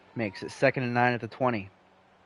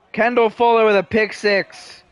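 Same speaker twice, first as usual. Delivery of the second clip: excited call; 8 semitones higher, 7 dB louder than usual. Real, not acted. Touchdown call.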